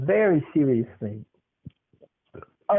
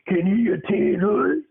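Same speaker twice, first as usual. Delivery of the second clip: high, loud sugary order; high drill-sergeant grandma; 5 semitones higher, 3 dB louder than usual. Retold or imitated. Grandson imitating grandma sweetly asking the parents, high and loud. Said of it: "she says".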